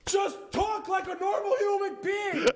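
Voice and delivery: slow, high, loud yell; exasperated shout. Acted. Ranting at the show's dialogue, exasperated shout.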